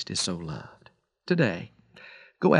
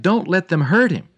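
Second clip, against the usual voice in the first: raised, pleading cry, higher and louder; cried out; 7 semitones higher, 6 dB louder than usual. Acted. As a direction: call out to spare him, pleading. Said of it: "and then cried".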